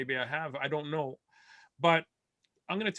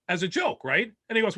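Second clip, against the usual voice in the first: normal talking voice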